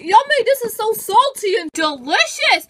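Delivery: over-the-top yummy; gleeful relish. Acted. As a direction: rave about the taste. Savouring salty tears.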